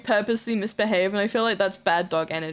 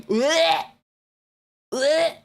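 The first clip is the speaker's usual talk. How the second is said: disgusted blech; 5 semitones higher, 3 dB louder than usual. Real, not acted. Disgust at roleplay idea.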